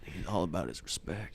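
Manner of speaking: dad drifting off to sleep; mock sleepy dad. Imitating dad falling asleep.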